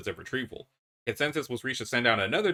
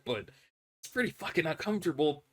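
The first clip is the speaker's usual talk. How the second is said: ordinary talk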